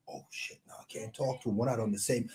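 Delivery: hushed, whispery mock aside; acted private grumble to yourself; muttered aside. Acted aside, avoiding an approaching fan.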